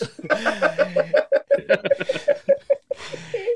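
long high burst of laughter. Real, not acted. Big laugh after bus joke.

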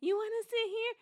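hammed-up high imitation of a friend; imitating friend's offer. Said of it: "That's how I sounded?".

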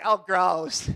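hammed-up revulsion; mock disgust. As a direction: imitate a listener grossed out. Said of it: "You say".

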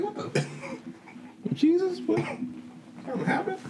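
very high, thin, shocked squeak; hammed-up falsetto surprise; mock startled squeal. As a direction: squeak it out like you just got nicked. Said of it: "you be like".

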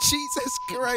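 squealed through laughter; laughing. Real, not acted. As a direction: gasp it out mid-laugh. Shocked by crude joke.

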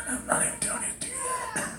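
high fast whisper; God whispering. Retold or imitated. God gently correcting the grumbler, quick whisper. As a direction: slip it in as a secret. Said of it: "he's like".

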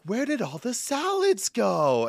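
high, drawn-out mock customer voice; clueless customer. Grocery worker retelling a customer's question, mock bewildered wail. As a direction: wail it like a hopelessly lost shopper.

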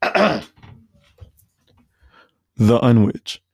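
loud drawn-out mock customer; imitating the customer. Imitating a rude customer ordering, a loud blunt drawl.

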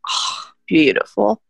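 ordinary talk, nothing marked

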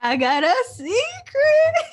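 high, loud, slow taunting singsong; smug Edward. Retold or imitated. Host echoes the Edward mockery with a loud high taunt. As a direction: tease like a gloating kid with a secret. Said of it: "he can't be like".

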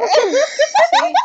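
sí entre carcajadas; riendo. Real, not acted. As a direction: se le escapa la risa. Risa tras cortar el audio.

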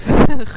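short loud laugh at her slip; embarrassed laugh. Real, not acted. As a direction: laugh off the mistake. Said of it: "nee, ik zeg dat niet goed".

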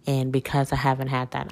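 ordinary talk, nothing marked

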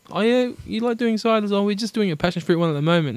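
high, lightly voiced chirpy shop assistant; imitating an upbeat brewery server; bubbly shop girl. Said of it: "she's, um".

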